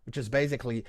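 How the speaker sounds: usual speaking voice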